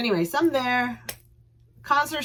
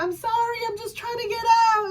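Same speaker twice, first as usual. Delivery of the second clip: squeaky apology. Retold or imitated. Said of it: "I'm like".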